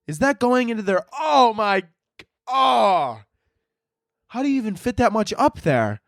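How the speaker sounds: high, horrified amazement; appalled saint. Acted. Saint peering at a shocking couple, appalled. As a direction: stare in high-pitched disbelief. Comic acting out saint looking down.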